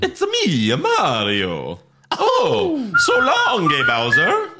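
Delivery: very high, squealing video-game hero; Mario. Acted. Host showing the voice everyone knows, high cartoon Mario. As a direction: swoop from falsetto down and back up, sing-song. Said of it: "everyone knows Mario with that voice".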